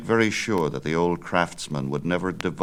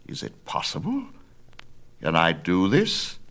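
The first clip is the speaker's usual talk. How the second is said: put-on wondering old Daedalus; 2 semitones higher than usual. Father wonders at son's idea, amazed.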